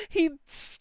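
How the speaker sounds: ordinary talk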